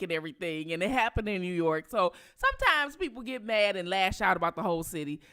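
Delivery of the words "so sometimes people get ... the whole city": ordinary delivery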